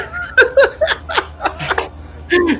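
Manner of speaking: long high cackling laugh. Real, not acted. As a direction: lose it laughing. Laughing at old clip.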